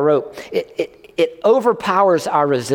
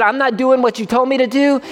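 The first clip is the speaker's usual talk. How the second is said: mock defiant; 8 semitones higher than usual. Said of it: "he said".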